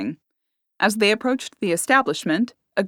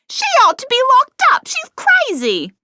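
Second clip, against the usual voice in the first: much higher, louder frantic cry; frantic shout; 6 dB louder than usual. A narrator's reading. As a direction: scream for help. Barmaid crying out for help.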